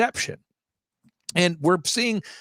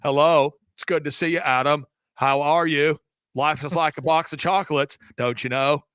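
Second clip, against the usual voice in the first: Forrest Gump; 3 semitones lower than usual. Acted. Host mocks meeting Tom Hanks in person, Forrest Gump impression. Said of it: "if he didn't talk like this".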